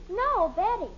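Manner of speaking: usual speaking voice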